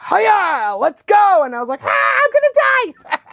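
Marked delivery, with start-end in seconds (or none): 0.1-1.4 high, punchy horse-driving call; gung-ho trainer. Retold or imitated. Trainer spurring the horse for a laugh, mock gung-ho call. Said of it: "the trainer thought it would be funny". 1.8-3.2 very high panicked squeal; mock terror. Frightened rider as the horse takes off, mock terrified squeal. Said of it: "not expecting to be this afraid".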